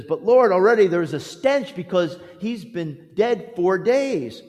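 ordinary talk

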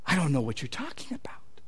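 near-whispered, low acted denial; very soft, evasive murmur; hushed denial. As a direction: whisper it as if cornered. Peter's cornered denial acted out.

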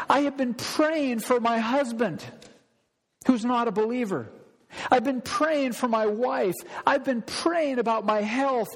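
high, insistent cry to God; anguished, pleading prayer. Acted. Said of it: "prayed a prayer like that".

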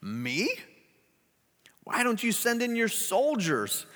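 can't believe he asks her; shocked. Acted. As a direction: Gladys answering the governor, shocked. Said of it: "Gladys was shocked".

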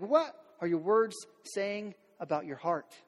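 normal talking voice

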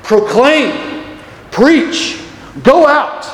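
high, forceful one-word commands; ringing heavenly summons; imperative proclamation. Acted. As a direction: call out each command, separated by pauses. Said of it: "it's an imperative".

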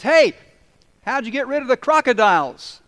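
very high, loud shout across the water; calling out. Acted. Swimmer halfway to shore calling to the local. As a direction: yell it toward the beach mid-swim.

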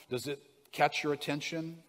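usual speaking voice

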